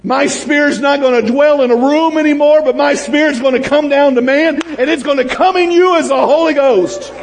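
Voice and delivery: a touch of booming God; voicing God. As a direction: proclaim as God, with fervour. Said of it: "God's saying".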